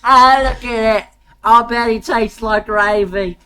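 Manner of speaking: crocodile-hunter Australian bloke; Australian accent. Acted. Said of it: "Make him Australian!".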